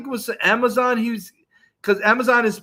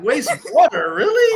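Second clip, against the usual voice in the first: mock disbelief; 8 semitones higher, 3 dB louder than usual. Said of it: "I'm like".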